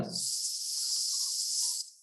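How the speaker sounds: long hissing, whistling "s-". Shown as a demonstration. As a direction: draw "s-" out into a whistle. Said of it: "have a whistling sound".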